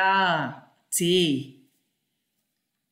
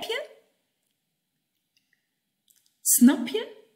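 high, louder, rising on "je"; high checking questions; 7 semitones higher, 3 dB louder than usual. Shown as a demonstration. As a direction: check if they understood, brightly. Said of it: "Dat is drie keer hetzelfde". (hoog en luid vragend)

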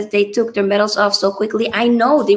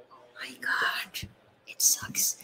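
whispered, much softer, crushed; dismayed whisper; 10 semitones lower, 13 dB quieter, slower than usual. Real, not acted. Streamer watching the Italian celebrations, whispering in dismay. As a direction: breathe it out in a whisper.

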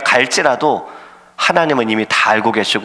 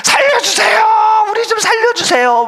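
desperate shout; 3 dB louder than usual. Acted. Sailors screaming to the gods in the storm, desperate shout. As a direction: scream for your life on a sinking ship. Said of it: "막 소리 치지 않았을까? 막 고래고래".

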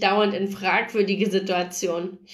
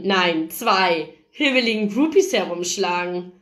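ordinary delivery, nothing marked

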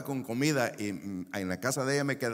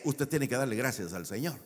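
ordinary delivery

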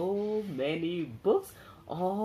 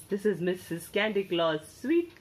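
ordinary delivery, nothing marked

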